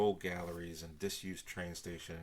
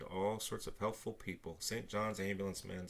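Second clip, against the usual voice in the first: ordinary delivery, nothing marked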